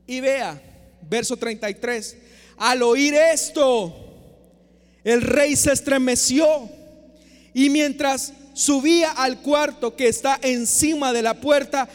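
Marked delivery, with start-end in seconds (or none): 2.6-6.7 slow, weighty and louder; grave sermon reading of the verse; dramatic reading. Acted. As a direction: lean on each word slowly.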